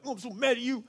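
imitating a sulking child, high-pitched; mad little boy. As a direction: play a small boy's cute tantrum. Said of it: "he'd be mad".

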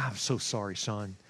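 low, hushed voice of sympathy; soft condolence. Retold or imitated. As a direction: offer quiet, fatherly condolences. Coach consoles bereaved boy.

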